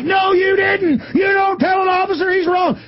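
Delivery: hammed-up bullying officer shout; mock shouting cop. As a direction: mimic a cop yelling down a driver. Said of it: "And he's like".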